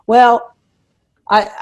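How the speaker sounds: ordinary talk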